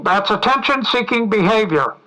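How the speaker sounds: pompous loud official lecturing a drowning man; megaphone shout. Retold or imitated. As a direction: bellow it down coldly through a megaphone. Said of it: "through the megaphone he shouts".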